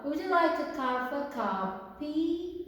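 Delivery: very slow, every word laid out for learners; slow, careful classroom English; slow model reading. Shown as a demonstration. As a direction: read it slowly like a teacher modelling. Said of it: "그 다음 문장 볼게요".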